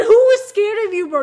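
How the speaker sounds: loud, high, incredulous laugh-talk; laughing taunt. Real, not acted. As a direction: burst out laughing while jeering.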